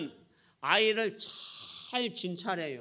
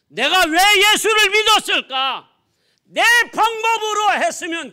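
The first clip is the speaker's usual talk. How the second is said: high, very loud cry of regret; anguished shout; 11 semitones higher, 13 dB louder than usual. Acted. Bereaved father regretting he believed in Jesus, anguished shout. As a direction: wail it out in bitter protest.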